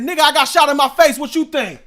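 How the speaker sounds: high, loud incredulous yell; shouting back at the cop who asks about an ambulance; exasperated shout. Retold or imitated. Retort to the cop.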